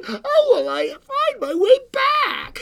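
put-on very high, loud, drawn-out child's wail; frightened little girl. A narrator's reading. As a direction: wail it like a scared small girl. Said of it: "she was suddenly frightened again".

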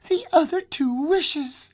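very high, excited voice; feverish excitement. A narrator's reading. Wife explaining the wishes to her husband, feverishly excited. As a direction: press it urgently in a high pitch.

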